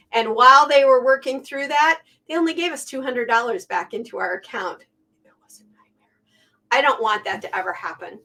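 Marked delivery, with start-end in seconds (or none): 5.2-6.1 breathy hush over the memory of the hack; whispered. Real, not acted. Telling viewers about the bank hack, whispered. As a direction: drop to a whisper, as if it still hurts.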